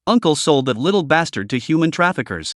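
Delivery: ordinary delivery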